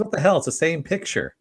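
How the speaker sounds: high-pitched retold incredulity; mock disbelief. Greta confronting Nadia with her obituary, mock disbelief.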